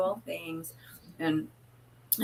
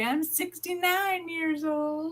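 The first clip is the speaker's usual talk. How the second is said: high, slow mock old-lady voice; playful high, slowed imitation of a friend admitting her age; Barbara teasing; 9 semitones higher, much slower than usual. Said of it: "And Barbara's gonna say".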